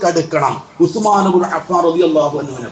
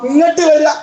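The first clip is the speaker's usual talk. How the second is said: calling out; 7 semitones higher, faster than usual. Acted. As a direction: call the faithful to come. God's call voiced in sermon.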